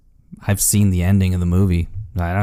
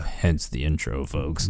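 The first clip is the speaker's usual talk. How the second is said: announcer aside; 7 semitones lower, 9 dB quieter, slower than usual. Pretend. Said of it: "aside to listeners".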